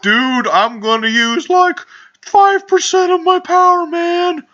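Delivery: very high, cracking slacker voice with slow start; hammed-up cartoon squeak, drawn out and loud; Shaggy. Ultra Instinct Shaggy threatening his victim, cartoon impression. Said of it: "doing the Shaggy voice is fun".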